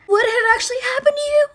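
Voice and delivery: very high, affronted mock voice; mocking mimicry. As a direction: mimic an overdramatic friend. Mimics friend's dramatic message.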